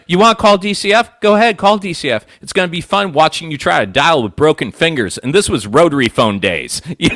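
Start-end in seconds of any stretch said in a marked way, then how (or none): 0.1-5.2 put-on high, loud dad daring the kid; dad taunting his son, mock menace; mock threatening dad. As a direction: threaten like an exaggerated angry father.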